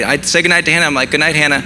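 ordinary talk, nothing marked

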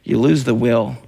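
ordinary talk, nothing marked

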